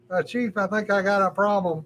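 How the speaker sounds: quoting her nervously to the chief; mock worried airman. She tells her chief about the barracks, lightly voiced and nervous.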